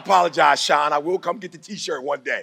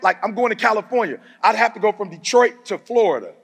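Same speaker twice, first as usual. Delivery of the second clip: high, loud, excited; 6 semitones higher, 6 dB louder than usual. Real, not acted. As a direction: build it up with energy.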